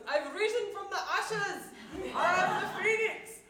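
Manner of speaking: hammed-up Scottish triumph with laughter; Scottish accent. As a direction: proclaim a rebirth in a mock Scottish voice. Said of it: "I'm not very good at the Scottish accent".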